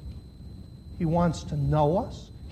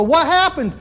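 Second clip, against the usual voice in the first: raised, higher startled "What happened?"; mock parent yell; 10 semitones higher, 8 dB louder than usual. Parent bursting in on child at cookie jar, mock yell. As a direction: play the parent bursting in, loud and high.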